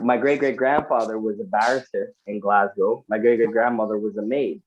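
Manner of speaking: ordinary talk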